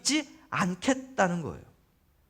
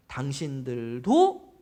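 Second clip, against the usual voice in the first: 당신들도 drawn out slowly, rising on 도; stress on 도; 6 semitones lower, much slower than usual. Shown as a demonstration. Preacher pointing out the key word, 도 drawn out. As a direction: stretch the final 도 of 당신들도 upward.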